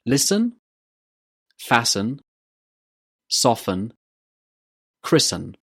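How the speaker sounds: Listen, fasten, soften, christen with no T sound; "LISS-en, FASS-en, SOFF-en, CRISS-en", word by word; silent T. Shown as a demonstration. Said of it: "the t in these words is silent".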